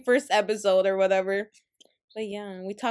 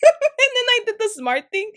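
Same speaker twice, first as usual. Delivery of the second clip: high, loud laugh spilling into the words; 12 semitones higher, 8 dB louder than usual. Real, not acted. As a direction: laugh at your own blunder. Laughing retelling her mistake.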